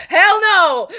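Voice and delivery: high, loud mock-stern hell no; firm refusal. Wife mimicking husband refusing the crying daughter. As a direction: bark it like a ruthless dad. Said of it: "But you were like".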